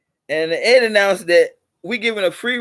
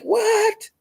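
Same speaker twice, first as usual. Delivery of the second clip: a sudden high, startled "What?"; jolted into disbelief; shocked. Retold or imitated. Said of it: "I was shocked".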